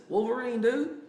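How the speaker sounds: high, bright excited reveal. Retold or imitated. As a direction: pitch it up high like sharing a treat. Telling kid the hero's name.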